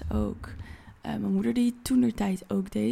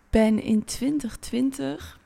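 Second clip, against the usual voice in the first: normal talking voice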